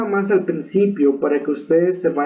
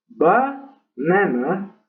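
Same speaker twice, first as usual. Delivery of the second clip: "Banana" slowly as BA-na-na; wrongly stressed BA in a slow "Banana"; stress on first syllable; much slower than usual. Shown as a demonstration. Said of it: "ese acento en la primera sílaba".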